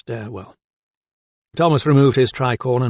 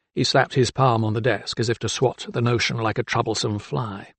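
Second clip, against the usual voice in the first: usual speaking voice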